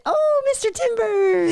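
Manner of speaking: high, drawn-out delighted cry; light up with joy, stretching the name; lit up. Acted. Halfling spots an old friend, high and delighted. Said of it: "she just lit up and said".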